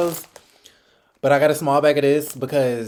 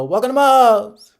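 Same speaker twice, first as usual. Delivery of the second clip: cheerful greeter; 8 semitones higher, 8 dB louder than usual. Retold or imitated. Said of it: "they go".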